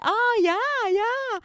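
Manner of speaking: very high, drawn-out American gushing; American person. Acted. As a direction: squeal agreement as a mock American. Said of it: "when an American person asks me".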